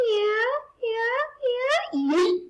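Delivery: high gleeful yeahs; excited cheering. Real, not acted.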